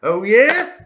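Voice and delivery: high, loud snide retort; spiteful neighbor. Acted. Neighbor taunting about symptoms, high loud snide voice. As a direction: neighbor snapping back to win the parking spot, high and loud.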